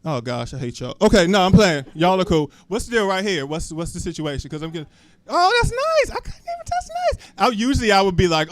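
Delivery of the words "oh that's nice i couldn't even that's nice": very high squeaky cooing; mock-sweet reaction way up high; gushing falsetto. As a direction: coo it in a tiny put-on squeal. Acts out gushing reaction to audience.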